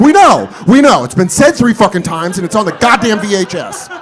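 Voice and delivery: loud, high irritated retort; exasperated. Retold or imitated. Viewer fed up with repeated Christmas announcements, exasperated. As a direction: snap back loudly at the tape.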